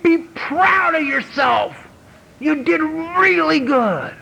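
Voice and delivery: high, slow, proud encouragement to the mirror; self-praise. Pretend. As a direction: cheer yourself on slowly. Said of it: "look at the mirror myself and say".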